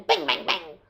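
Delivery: low, abrupt gun bangs; imitated gunshots. A narrator's reading. Hunters fire their guns along the trail, abrupt bangs. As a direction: shoot the words out percussively.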